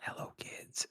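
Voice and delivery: hushed whisper; whispered dad ASMR. Retold or imitated. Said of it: "your dad A S M R".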